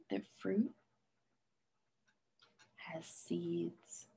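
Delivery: soft unvoiced whisper trailing away. Real, not acted. Teacher whispering her notebook note to the class. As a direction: breathe it like a little secret.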